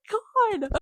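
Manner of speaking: high, laughing exclamation; cracking up. Real, not acted. Reader appalled by the villain's line, high laughing. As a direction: break character into real laughter. Breaks up at her own acting.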